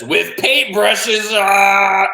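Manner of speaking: high, loud, drawn-out playful cry; gleeful octopus. Acted. Octopus reveals how he brushes teeth, gleeful. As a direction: stretch it out with manic glee. Octopus springs silly surprise.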